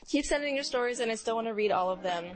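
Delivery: usual speaking voice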